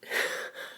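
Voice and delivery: a soft, breathy sob-laugh; tearful laugh. Real, not acted. After praising his mom, a tearful laugh. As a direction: let a wet laugh escape. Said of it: "I'm sorry about the crying".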